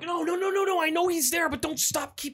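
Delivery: high, urgent mock begging; pleading priest. Priest begging monster not to stop, hammed-up pleading. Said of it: "the priest is like".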